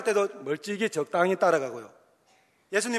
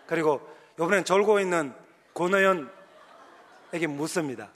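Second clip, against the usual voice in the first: normal talking voice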